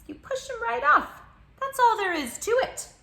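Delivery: put-on high, loud, bossy child; indignant shout. Child ordering the tiger away, high bossy shout. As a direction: shout him off the tricycle.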